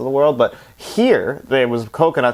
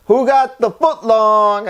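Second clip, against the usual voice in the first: high, drawn-out call to the dogs; 8 semitones higher, much slower than usual. Retold or imitated. Harry handing out food to his dogs in the van, calling out. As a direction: sing out the order, stretching it long. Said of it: "he's calling them all out".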